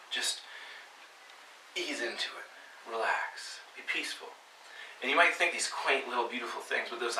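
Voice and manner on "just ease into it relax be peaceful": soft, slow, half-whispered calm; soothing soft voice. Shown as a demonstration. Preacher mocking the relaxed reading, soft and slow. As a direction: ease them into it gently.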